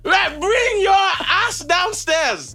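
high, slow, drawn-out yell up the stairs; angry mom yelling. Retold or imitated. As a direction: call him down like a furious mother. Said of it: "it was more like".

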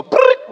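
high, loud rolled trill; quick brrrt for slices flung down; trilled zipping sound effect; imitated rapid-fire noise. Imitates fast sandwich topping.

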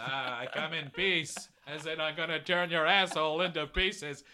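alien talking like a Kennedy. Acted. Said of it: "why does he sound like a Kennedy".

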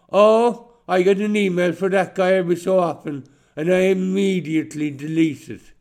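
high, loud dismissive sneer; condescending. Retold or imitated. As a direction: brush him off from above. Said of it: "Very condescending, very dismissive".

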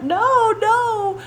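put-on high, drawn-out "no" of a protesting kid; child's mock denial. Mom imitating her son denying he slapped someone, high-pitched protest.

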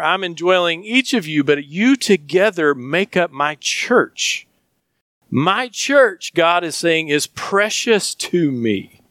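preaching with passion; fervent preaching. Acted. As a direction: press God's claim on his church. Preaching with rising fervour.

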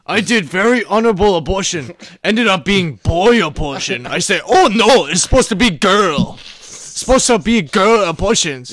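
loud, broken-English mock Japanese accent. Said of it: "That's a Japanese accent".

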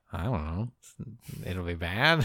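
low, quiet doom-laden voice; ominous mock mumble. Characters warning of stakes, low ominous mumble. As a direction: mutter the threat darkly.

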